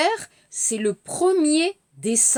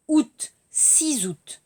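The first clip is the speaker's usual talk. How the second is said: slow careful; 3 semitones higher, slower than usual. Shown as a demonstration. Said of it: "Alors, six".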